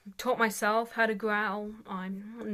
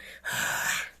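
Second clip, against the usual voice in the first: growled how. Shown as a demonstration. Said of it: "if you go".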